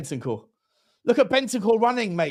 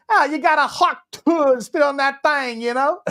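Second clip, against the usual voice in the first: higher, louder Tennessee girl impression; comic Southern Tennessee twang; Tennessee accent; 7 semitones higher, 4 dB louder than usual. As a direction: mimic a viral Tennessee girl's accent. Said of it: "the girl from, um, Tennessee".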